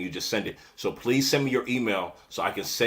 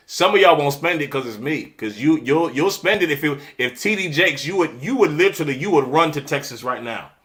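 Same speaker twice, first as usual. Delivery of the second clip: louder, higher, calling out her viewers; choosing violence; 6 semitones higher, 8 dB louder than usual. Real, not acted. Host calling out viewers who skip her class, heated. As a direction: throw shade at the audience, heated. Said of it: "I'm choosing violence".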